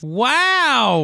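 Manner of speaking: high amazed wow; stunned and delighted; surprised. Real, not acted. Surprise at best man pick.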